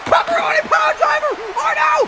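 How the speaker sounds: high screaming hype call; excited scream. Acted. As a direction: scream the move name in shock. Announcers scream at big move.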